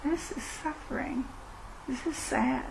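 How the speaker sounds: gently name the pain to yourself; quiet, tender voice of noticing; soft self-compassion. Shown as a demonstration. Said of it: "come back to the moment".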